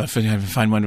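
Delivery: low, sinister movie-villain imitation ending in slurps; mock a film cannibal, voice dropped; Hannibal Lecter. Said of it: "he goes all Hannibal Lecter".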